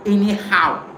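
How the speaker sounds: usual speaking voice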